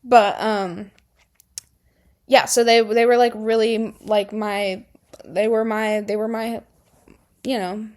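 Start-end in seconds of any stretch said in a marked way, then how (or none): none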